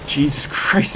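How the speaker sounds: breathy startled oath; startled exclamation. Real, not acted. Caught off guard by zombies, startled oath. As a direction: blurt it out in shock.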